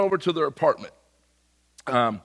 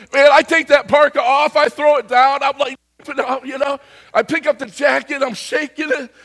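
frantic retelling; 10 semitones higher, 9 dB louder than usual. Retold or imitated. Frantically shaking off the parka.

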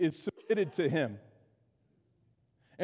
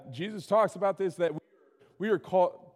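usual speaking voice

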